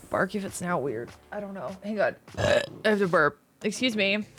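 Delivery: ordinary delivery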